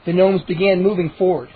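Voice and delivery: usual speaking voice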